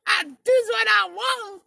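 high shouted cheer; mock cheering. People praising Henry, mock cheer. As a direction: cheer him on like a crowd.

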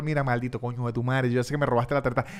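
ordinary talk

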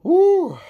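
high, loud party whoop; excited whoop. Real, not acted. Storyteller cheering at the memory, loud high whoop. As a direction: let out a loud celebratory woo.

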